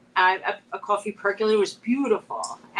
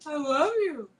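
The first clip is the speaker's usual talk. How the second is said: high, coaxing pet voice; talking to a dog; 6 semitones higher than usual. Pretend. Owner prompting her dog to talk, high pet voice.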